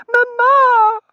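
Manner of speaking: piercing, very loud high cry; shriek. A narrator's reading. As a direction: shriek for your mother. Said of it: "Totsie shrieked".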